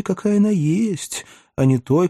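ordinary delivery

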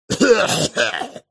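choking cough on bad smoke; sudden harsh cough from pipe smoke. Inhales wrong smoke mixture.

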